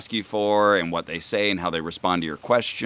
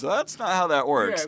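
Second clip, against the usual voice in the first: quoting Kerry; 7 semitones higher than usual. Retold or imitated. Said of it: "all-time best Kerry lines".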